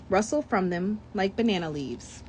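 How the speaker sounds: ordinary delivery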